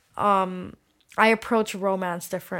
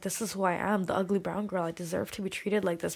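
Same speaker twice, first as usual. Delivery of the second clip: resigned self-belittling; 7 dB quieter than usual. Retold or imitated. Woman quoting her old view of herself, quietly resigned. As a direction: say it quietly, as accepted fact.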